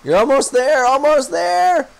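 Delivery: cheering on. Real, not acted. Streamer cheering a friend landing a fish, excited and drawn out. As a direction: urge them over the line.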